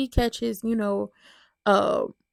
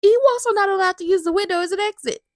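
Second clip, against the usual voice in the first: high, strict scolding mom voice, louder toward the end; mom laying down the law; 9 semitones higher, 4 dB louder, faster than usual. Retold or imitated. Mom scolding her son, high mock-stern voice. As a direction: list the rules like a fed-up mother. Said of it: "And Max is like".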